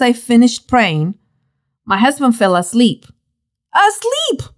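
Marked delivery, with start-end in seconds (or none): 3.7-4.5 high-pitched astonished exclamation; incredulous. A narrator's reading. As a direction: exclaim in disbelief, pitch leaping up. Indignant that husband slept.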